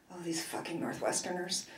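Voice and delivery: hushed, breathy curse under the breath; whispered curse. Acted. Annoyed mother whispering a curse at the ferry worker. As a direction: hiss it so he can't hear. Muttered curse at helpful stranger.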